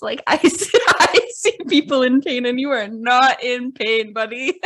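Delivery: loud, laughing scorn; laughing callout. Retold or imitated. Telling the faker off, laughing and loud. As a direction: call him out, laughing. Calling out his faking.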